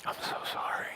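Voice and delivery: very soft, voiceless apology; sorrowful whisper. Acted. Last servant facing Job with terrible news, whispering. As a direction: barely get the words out. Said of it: "can't even make eye contact".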